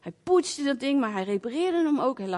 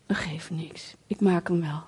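gentle reassurance; 7 semitones lower than usual. Retold or imitated. Brother gently forgiving her.

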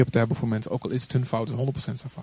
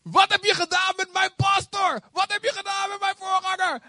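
indignant shout. Retold or imitated. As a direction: defend her pastor at full indignant volume. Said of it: "en toen zei ze".

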